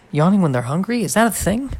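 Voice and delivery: rising, disbelieving questions; can't believe what he read; incredulous. Real, not acted. Surprised at a strange claim.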